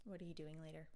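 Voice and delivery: no upward lift at the end; deadpan question with a dropping end; flat falling question. Shown as a demonstration. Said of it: "an upwards inflection".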